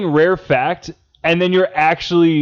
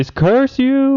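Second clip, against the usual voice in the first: high, drawn-out melodramatic curse; mock scream to heavens; 11 semitones higher than usual. Friend teasing about blown tire, mock anguished cry. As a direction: wail it skyward in pretend outrage. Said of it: "Did you scream to the heavens".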